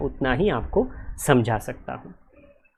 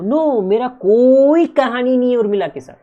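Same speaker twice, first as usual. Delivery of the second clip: emphatic no; 8 semitones higher, 5 dB louder than usual. Shown as a demonstration. Teacher emphatic denial.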